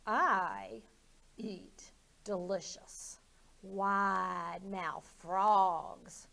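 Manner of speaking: drawn-out hungry threat; sly slow predator voice; menacing alligator. Acted. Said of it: "replied the alligator".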